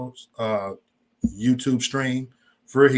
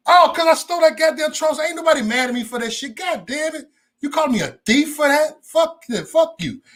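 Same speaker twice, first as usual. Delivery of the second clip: high-pitched angry protest; indignant outburst; 13 semitones higher than usual. Retold or imitated. Streamer answers John's accusation of being a thief, indignant. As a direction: fire back, voice pitched way up. Acts out his angry comeback to John.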